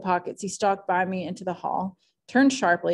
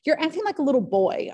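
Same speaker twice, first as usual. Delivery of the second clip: higher, sharp impatience; impatient outburst; 8 semitones higher than usual. A narrator's reading. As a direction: scold him like a child. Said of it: "I broke out impatiently".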